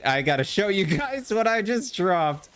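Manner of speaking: eager to show off the loot; excited. Real, not acted.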